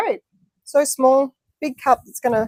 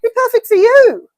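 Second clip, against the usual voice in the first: high teasing; 11 semitones higher, 7 dB louder than usual. Acted.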